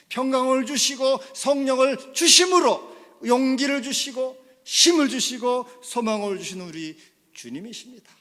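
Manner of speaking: high, loud rolling sermon cadence; fervent preaching. Real, not acted. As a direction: build the list with full pulpit force. Preaching at full force.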